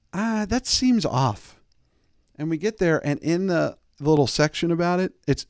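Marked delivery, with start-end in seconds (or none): 0.1-1.6 suspicious. Retold or imitated. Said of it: "I'm just like".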